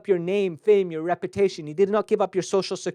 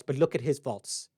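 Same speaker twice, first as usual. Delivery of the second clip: lower, softer snide turn against another; duplicitous aside; 5 semitones lower, 5 dB quieter than usual. Retold or imitated. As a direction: drop into a sly, pointing aside. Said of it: "we are being duplicitous".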